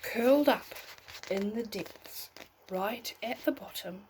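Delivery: put-on gentle storybook read for a child at bedtime; bedtime story narration. A narrator's reading. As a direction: tell it like a bedtime tale, unhurried.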